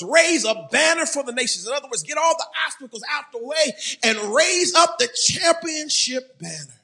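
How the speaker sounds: high, loud preacher's scripture reading; ringing, punched pulpit delivery; impassioned preaching. Real, not acted. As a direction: proclaim it to the whole church.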